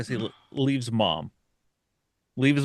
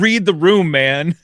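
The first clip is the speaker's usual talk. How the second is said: louder and higher, fed up with his buddy; exasperated outburst; 5 semitones higher, 8 dB louder than usual. Retold or imitated. Teen ghost telling off the demon, loud exasperated outburst. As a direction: snap at him to read the room.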